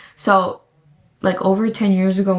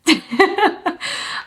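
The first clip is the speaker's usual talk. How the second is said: giggle; 10 semitones higher than usual. Real, not acted. Excited reveal of new teeth.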